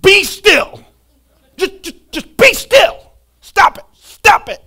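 put-on a frazzled dad begging kids to calm down; mock exasperated shout; exasperated parent. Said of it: "I just wanna be like".

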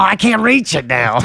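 squeaky, slow little character; high squeaky voice. Pretend. Small character failing to reach the wheel, high squeaky voice. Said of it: "I'm gonna talk like this".

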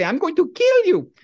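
high, slow shrieking mother voice; hammed-up mock mother threat; mother's mock threat. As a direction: shriek it like an outraged mom. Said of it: "she'd say".

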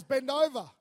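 loud barked command to bend over; stern order. Retold or imitated. Teacher ordering the boy to bend over, loud stern command. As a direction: snap it out like a strict teacher.

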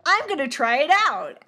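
imitating a cheerful killer; brightly upbeat mock announcement. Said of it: "she very upbeat says".

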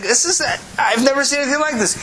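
high-pitched mock astonishment; amazed. Visitor marvelling at the talking dog, high amazed voice. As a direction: gush in put-on disbelief.

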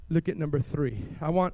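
normal talking voice

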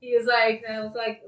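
normal talking voice

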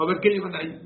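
loud demanding cop; stern policeman. Acted. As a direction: stop them at the checkpoint. Policeman stops the smugglers.

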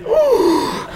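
high, theatrical gasp; exaggerated shocked gasp. Shown as a demonstration. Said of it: "My shocked face would be".

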